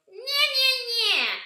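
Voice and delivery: very high loud taunting nya, nya; mock the kids in the playground; ear-piercing nya. Shown as a demonstration. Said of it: "make it really ear piercing".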